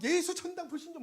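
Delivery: high-pitched zealot's slogan; mock street evangelist. Pastor mimicking an overzealous doomsayer, mock frantic.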